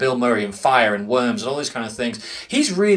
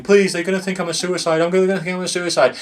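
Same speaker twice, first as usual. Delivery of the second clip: higher, hurried imitation of panicked begging; mildly acted desperate pleading; mock crying plea; 5 semitones higher, faster than usual. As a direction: retell a tearful scream without fully screaming. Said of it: "is crying and screaming".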